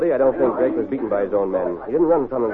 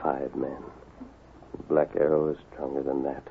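low, soft musing in short phrases; hushed, uneasy thinking aloud; brooding thought; 7 semitones lower, 11 dB quieter than usual. Acted. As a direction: puzzle it out privately, with long pauses. Masked man thinking apart, uneasy.

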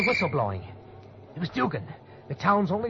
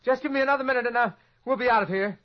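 flustered calling back; 9 semitones higher, 5 dB louder than usual. Acted. Flustered man calls back through door.

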